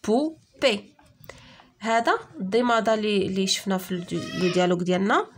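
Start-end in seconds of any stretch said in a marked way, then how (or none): 0.1-0.9 two separate beats on بوبي; syllable by syllable. Shown as a demonstration. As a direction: split بوبي cleanly between its syllables. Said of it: "كنسمعوها في لي دو سيلاب". (بوبي مقطعة على جوج سيلاب)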